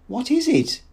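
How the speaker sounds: very high, frightened question; high-pitched alarm; almost screamed. A narrator's reading. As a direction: cry out just short of a scream. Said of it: "she almost screamed".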